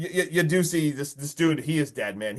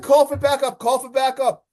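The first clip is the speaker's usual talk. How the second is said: high, insistent mock plea; hammed-up urgent pleading; imitated begging; 8 semitones higher, 4 dB louder than usual. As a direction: beg frantically, pitched well up. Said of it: "he's begging him to call".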